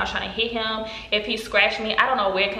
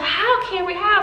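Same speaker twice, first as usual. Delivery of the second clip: worried; 10 semitones higher, slower than usual. Retold or imitated. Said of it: "she's like".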